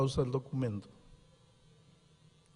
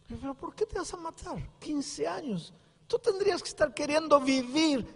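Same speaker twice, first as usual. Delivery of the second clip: pastor's reply; 13 semitones higher than usual. Retold or imitated. Pastor quoting himself to girl.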